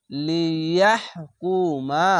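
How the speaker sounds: লিয়াহকুমা chanted slowly, vowels drawn long; Quranic recitation. Shown as a demonstration. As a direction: recite slowly, stretching each syllable. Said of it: "এইখানে". (ধীরে টেনে তিলাওয়াত)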